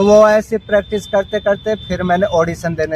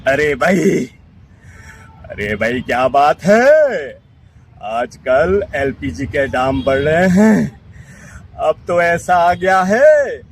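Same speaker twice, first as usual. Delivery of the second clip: Amrish Puri; slower than usual. Retold or imitated. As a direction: growl it out like a classic Bollywood villain. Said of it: "जैसे अमरीश पुरी जी, पुराने दमदार villain".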